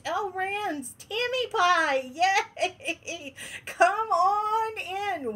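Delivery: high, giggly yay, then a slow come on in; delighted laughing greeting; laughing welcome. Real, not acted. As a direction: gleefully laugh them in the door. Greeting viewers joining livestream.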